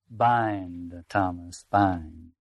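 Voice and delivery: very low, drawn-out single words; commanding tempter. Acted. Tempter urging Thomas to bind, low solemn intoning. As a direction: intone it slowly and deeply, pausing between words.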